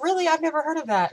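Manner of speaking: high, loud surprise; imitated surprised friend. Friend puzzled by the Welsh city, imitated high surprise.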